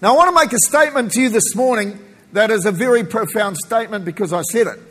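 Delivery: normal talking voice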